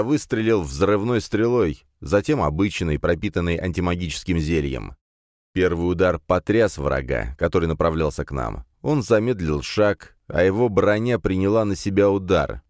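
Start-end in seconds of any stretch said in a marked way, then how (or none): none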